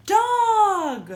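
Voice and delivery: high, loud, drawn-out animal reveal; excited reveal. Pretend. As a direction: announce it with big delight.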